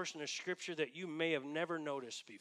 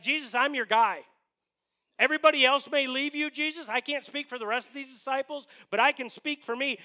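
bragging, loud and higher-pitched pledge; Peter; 5 semitones higher, 8 dB louder than usual. Acted. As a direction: cocksure loyal promise, pushed up and out. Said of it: "Peter was kind of bragging on himself".

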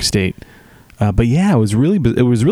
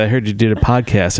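ordinary talk

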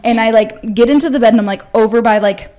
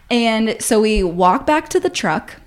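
ordinary delivery

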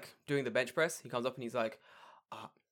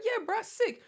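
mock hype; 11 semitones higher than usual. Mock reply in same skit.

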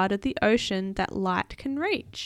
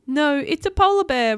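high, loud creature reply; excited, higher creature voice; spookfish voice; 7 semitones higher, 10 dB louder than usual. A narrator's reading. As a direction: correct them loudly as a storybook creature. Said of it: "replied the spookfish".

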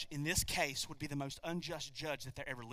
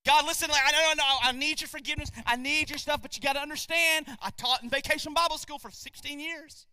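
mock excuses to God; 8 semitones higher, 4 dB louder than usual. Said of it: "by saying".

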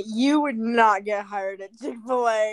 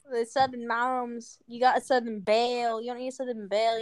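ordinary delivery

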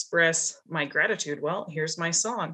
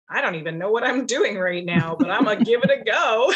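higher, louder, laughing through the words; 4 semitones higher, 6 dB louder than usual. Retold or imitated. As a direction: toss it off with a giddy laugh. Imagined self-talk, laughing it off.

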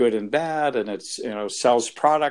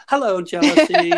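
much higher, louder, sing-song greeting; cheery workshop participant; 11 dB louder than usual. Retold or imitated. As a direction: greet the feeling like an old friend, bright and high. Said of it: "come up to me during the workshops and just go".

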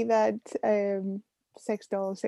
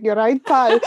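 put-on Billy Connolly accent; 7 dB louder than usual. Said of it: "a Billy Connolly kind of accent".

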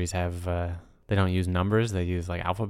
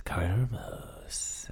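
very slow, soft fading echo; hushed self-echo; 8 dB quieter, much slower than usual. Pretend. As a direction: draw the name out softly like a reverb. Softly echoes his own name.

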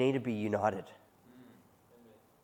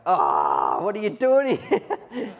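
high, slow, loud squeal of alarm; a panicked yelp of surprise at the boss; startled shriek; 9 semitones higher, 8 dB louder, slower than usual. Retold or imitated. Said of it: "and Chris is like".

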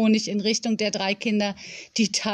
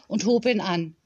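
usual speaking voice

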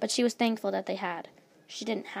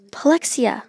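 loud, raised cry of realisation; exclaiming; 4 semitones higher, 14 dB louder than usual. A narrator's reading. Luna suddenly works out who helped, exclaiming loudly. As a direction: burst out with the name.

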